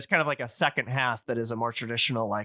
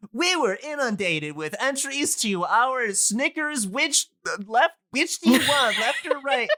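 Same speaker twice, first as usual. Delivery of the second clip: high, much higher mock PR voice; pitched-up corporate hype, teasing; mock corporate spokesman; 11 semitones higher than usual. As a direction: ham up a cheesy company announcement. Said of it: "a company today would be like".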